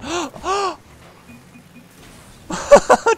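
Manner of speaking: loud, high gasp of disbelief; big high-pitched gasp; shocked gasp. Real, not acted. Shocked by own save.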